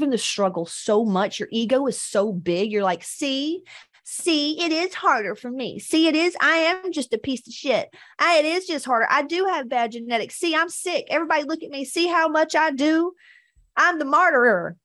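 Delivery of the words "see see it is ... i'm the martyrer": high, self-pitying whine, each "See" pleading; mock her own old victim self, whining for attention; whiny martyr voice. Mocking her past self to viewers, high martyr whine. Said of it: "I can talk like this".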